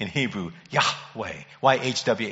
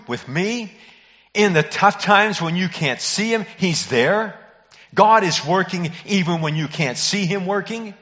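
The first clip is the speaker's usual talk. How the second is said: higher, slowing on the first words; rising preaching; 6 semitones higher than usual. Acted. Pastor assuring listeners God is present, rising preaching. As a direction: build the promise to the congregation.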